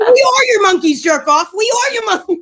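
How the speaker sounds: loud, high, joking protest; mock indignant comeback. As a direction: pretend to yell back, half laughing.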